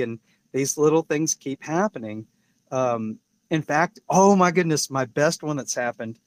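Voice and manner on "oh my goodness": a bright, louder oh my goodness; excited exclamation. Real, not acted. Introducing his best story, excitedly. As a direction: burst out with delight. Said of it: "exclaims before best story".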